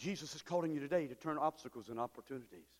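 usual speaking voice

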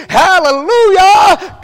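long, loud, high hallelujah cry; exultant hallelujah. Real, not acted. Preacher crying out praise to the church, exultant shout.

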